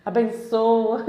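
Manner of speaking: loud, stiff and solemn, slow on the second word; hammed-up formal address to a father; stiff formal prayer. Mocking how we pray stiffly to God, loud and solemn. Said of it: "você fala".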